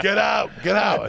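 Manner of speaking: host yelling at the singer, hammed up; mock shouting host. Karaoke host throwing the comic out, mock shouting.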